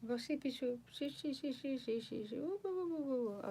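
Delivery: high, soft cooing to a sleepy pet. Real, not acted. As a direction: coo the dog off to sleep, high and hushed. Cooing to the dog at bedtime.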